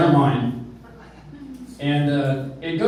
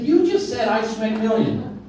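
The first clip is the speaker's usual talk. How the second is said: puzzled; 6 semitones higher than usual. Retold or imitated. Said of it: "I said".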